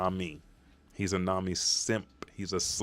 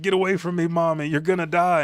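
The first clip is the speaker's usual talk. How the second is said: high, loud mock-panicked cry; acted urgent plea; 10 semitones higher, 8 dB louder, faster than usual. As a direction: act out a child warning mom away. Child warns mother away, retold.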